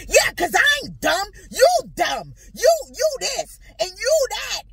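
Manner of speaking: loud, high acted rant; yelling. Partner throwing the word dumb back at wife, yelling. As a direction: fire back insults at the top of your voice. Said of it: "forgive me for yelling".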